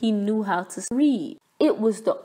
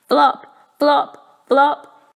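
loud, high bouncy flop flop flop; flopping sounds; 4 semitones higher, 5 dB louder, much slower than usual. A narrator's reading. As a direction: bounce each flop like a clumsy frog.

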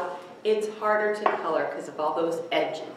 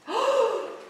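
much higher sharp gasp; dramatic gasp. Acted. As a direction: gasp for the little ones. Storyteller reacts to reveal.

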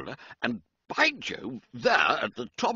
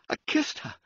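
breathless, ashamed whisper; whispered confession; faster than usual. A narrator's reading. George confessing the kiss to Reggie, a whisper. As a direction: barely voice it.

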